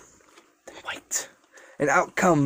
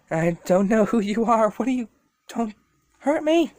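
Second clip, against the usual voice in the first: put-on scared child; 5 semitones higher than usual. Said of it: "this quivering and quivering".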